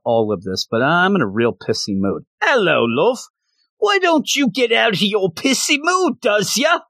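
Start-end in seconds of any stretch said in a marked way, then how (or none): 2.4-3.2 a lightly posh, rising royal greeting; Queen of England. Acted. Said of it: "That's the Queen of England". 3.8-6.8 much higher, prim royal-lady voice; Queen of England. Acted. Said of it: "That's the Queen of England".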